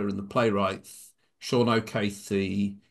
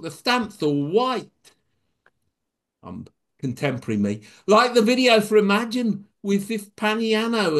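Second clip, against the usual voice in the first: lively comic poem reading, higher; poem recital; 7 semitones higher than usual. Acted. Poet performing his comic verse to the group. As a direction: perform a funny rhyming poem.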